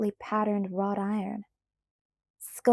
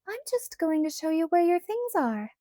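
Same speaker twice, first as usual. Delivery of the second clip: higher, mock-innocent girlish tone; 8 semitones higher than usual. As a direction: play it all innocence. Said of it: "she said innocently".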